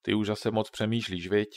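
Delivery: ordinary delivery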